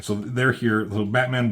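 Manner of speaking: ordinary delivery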